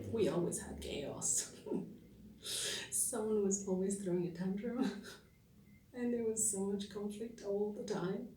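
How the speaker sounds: laughing between phrases; rueful chuckle at family chaos; amused recollection. Acted. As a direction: let little laughs break into the telling. Laughs recalling chaotic family.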